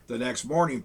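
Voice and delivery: ordinary delivery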